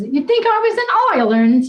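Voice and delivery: put-on clowning around in a half-hearted Irish accent; mock Irish accent. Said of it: "Not the right accent".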